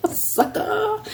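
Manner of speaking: giggling through the word; a high squeak of laughter on sucker; laughing. Real, not acted. Amused at the fabric name, laughing it out.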